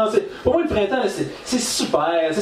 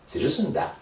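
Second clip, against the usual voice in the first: deadpan; 3 semitones lower, 6 dB quieter than usual. Retold or imitated. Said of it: "je suis comme".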